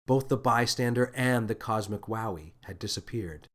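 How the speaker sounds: usual speaking voice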